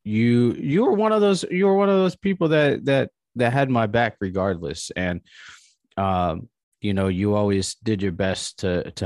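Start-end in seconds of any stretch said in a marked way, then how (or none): 0.5-4.9 high, strained and tearful; choking up while thanking her; voice breaking with emotion. Real, not acted. To his friend Kelly, voice breaking with real emotion.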